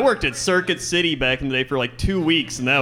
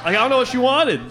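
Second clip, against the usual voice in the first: laughing; 9 semitones higher, faster than usual. Real, not acted. Laughing at own story.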